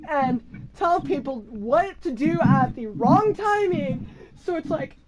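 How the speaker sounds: normal talking voice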